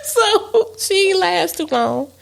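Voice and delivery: high laughing words. Real, not acted. Laughing at the joke.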